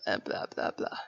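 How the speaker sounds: usual speaking voice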